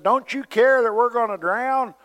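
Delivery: high, urgent cry over the storm; mock panic, pitched high; panicked disciples. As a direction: plead like you're sinking. Disciples wake Jesus in storm.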